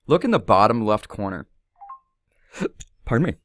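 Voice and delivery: hiccuping mid-sentence; words broken by a hiccup. Real, not acted. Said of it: "Excuse me, I got the hiccups".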